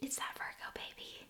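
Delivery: breathy joking whisper; wife whispering a joke about her star sign; whispered aside. Real, not acted. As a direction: whisper it like a secret.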